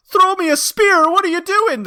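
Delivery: high-pitched movie-character imitation; mock frantic yell. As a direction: call out in a hammed-up high voice. Imitating Jim Carrey's film character shouting.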